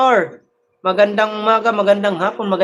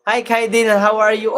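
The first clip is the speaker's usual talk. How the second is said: normal talking voice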